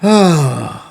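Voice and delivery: ordinary talk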